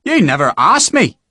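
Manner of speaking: indignant. Acted. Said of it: "Harper said indignantly".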